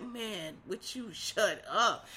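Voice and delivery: slow, exhausted groan of a line; weary mock Biden. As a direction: drag it out like you're fed up. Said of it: "He was like".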